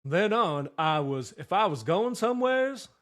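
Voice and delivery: cohost asked for a Gump, he drawls it slow and loud; Forrest Gump accent. Retold or imitated. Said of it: "You're so good at the accents".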